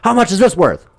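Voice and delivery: high, loud threatening question; menacing mock question. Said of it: "He's like".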